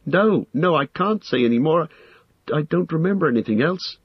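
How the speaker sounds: high, distressed hobbit voice; frightened refusal. A narrator's reading. Hobbit breaks down retelling torment.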